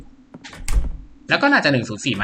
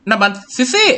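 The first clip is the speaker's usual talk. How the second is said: put-on girl's voice; 8 semitones higher, 5 dB louder than usual. Voicing Sissy alarmed in game.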